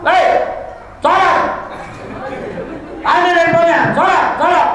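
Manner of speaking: angry mother. Retold or imitated. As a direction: bark orders like an angry mother. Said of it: "Kalau ibu marah". (ibu marah membentak dengan suara tinggi)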